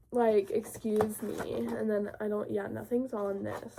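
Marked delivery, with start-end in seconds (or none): none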